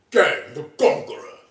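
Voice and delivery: lightly voiced Kang the Conqueror. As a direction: boom out the name like a conqueror. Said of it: "That's how he sounds".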